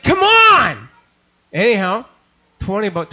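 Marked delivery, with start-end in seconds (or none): 0.0-0.9 high, loud, exasperated yell; a fed-up pastor shouting from the truck; impatient shout. Retold or imitated.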